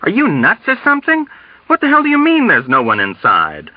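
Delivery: high, incredulous questions; agitated disbelief edged with dread; dread-filled shouting. A narrator's reading. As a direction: challenge him in alarm. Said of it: "he shouted with dread".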